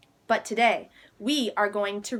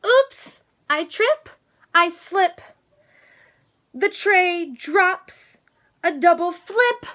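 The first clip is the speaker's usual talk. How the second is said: much higher and louder, tumbling words; dramatic tumble; 7 semitones higher, 4 dB louder, slower than usual. A narrator's reading. Reading to children as Nancy trips and drops the tray, higher and alarmed. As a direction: narrate the fall like a mishap unfolding.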